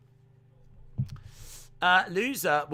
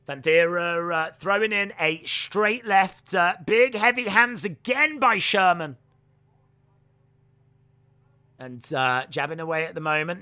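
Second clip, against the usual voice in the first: play-by-play commentary; 3 semitones higher, 4 dB louder than usual. Real, not acted. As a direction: lively fight commentary. Live fight commentary.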